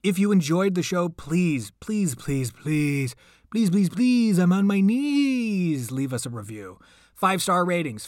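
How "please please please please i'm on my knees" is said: plain reading voice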